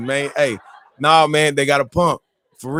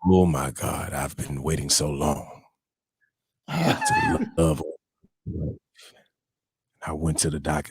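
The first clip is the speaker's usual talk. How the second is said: far lower and softer all through, telling his story; brother's deep voice; 11 semitones lower, 6 dB quieter than usual. Retold or imitated. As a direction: keep the friend's deep hushed voice going. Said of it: "I didn't like the voice you just used".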